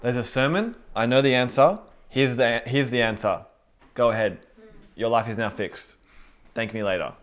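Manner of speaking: loud, cocky preacher with all the answers; mock your own self-satisfied fix-it tone; smug know-it-all. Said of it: "I was like".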